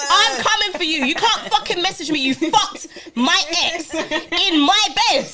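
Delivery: high, loud mock rant with laughter; hammed-up threat, half laughing; mock furious rant. Mock rant at the ex's girlfriend.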